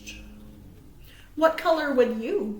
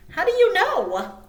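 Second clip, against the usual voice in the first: a touch higher and brighter, chirping; chirpy Little Bird; 7 semitones higher, 6 dB louder than usual. Acted. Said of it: "chirped Little Bird".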